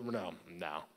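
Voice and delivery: normal talking voice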